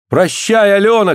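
high-pitched farewell cry; acted shouted goodbye; calling out. A narrator's reading. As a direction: call after her as she is taken away. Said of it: "крикнул Андрей".

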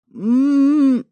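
mooing at the top of her lungs; loud gagged moan. A narrator's reading. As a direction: moan loudly through a gag. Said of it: "замычала я во всё горло".

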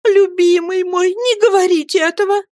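high, piteous woman's voice; imploring lament to a beloved; very plaintive pleading. A narrator's reading. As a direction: beg pitifully, pitch lifted far up. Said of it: "очень жалобным голосом взмолилась матушка".

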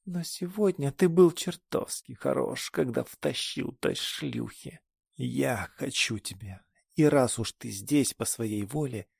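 slurred and seductive; tipsy, seductive. Acted.

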